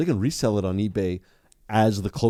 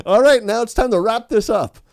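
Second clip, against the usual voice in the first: brisk dismissive date; 14 semitones higher, 8 dB louder than usual. Acted. Acted date ending it, laughing.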